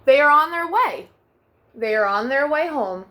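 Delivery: put-on gentle storybook narration for children; bedtime story reading. A narrator's reading. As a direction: read it like tucking kids in at night. Reads second picture book aloud.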